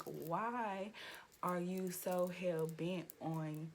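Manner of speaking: ordinary delivery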